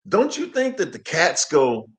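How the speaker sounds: ordinary talk, nothing marked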